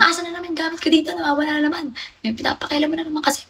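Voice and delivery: fast, high-pitched mock yell; retold angry yelling. Yelling through the house over her missing things, a mock angry outburst. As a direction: re-enact shouting at the house about missing things. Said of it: "nagsisigaw-sigaw ako doon".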